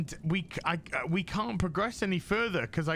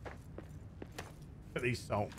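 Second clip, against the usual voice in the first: whisper; 4 semitones lower, 9 dB quieter, much slower than usual. Pretend. Slow whispered mock remark.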